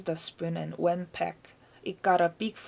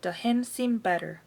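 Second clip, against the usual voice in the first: usual speaking voice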